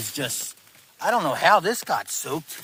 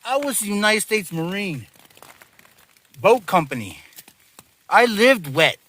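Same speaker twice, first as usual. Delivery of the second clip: high, loud defiant pep talk; primal defiance; 7 semitones higher, 5 dB louder than usual. Real, not acted. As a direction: psych yourself up, fierce. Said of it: "get angry and get primal".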